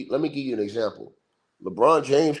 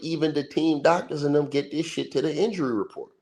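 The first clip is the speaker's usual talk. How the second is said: usual speaking voice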